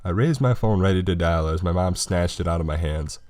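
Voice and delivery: plain reading voice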